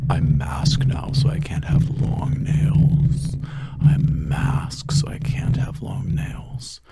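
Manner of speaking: forceful stage whisper; loud whisper. Real, not acted. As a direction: push the whisper out hard.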